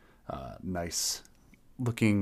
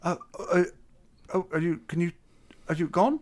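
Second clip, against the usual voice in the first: high, stammering nervous greeting; timid satyr voice; 8 semitones higher, 4 dB louder, faster than usual. Acted. To the tavern crowd, a high, stammering shy hello. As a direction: squeak out a shy hello at the bar.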